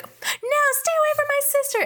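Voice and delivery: very high, fast mock defiance; high, hurried mock protective cry; protective sister. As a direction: rush it out like a brave little sister. Debbie defends her sister.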